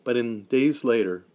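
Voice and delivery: usual speaking voice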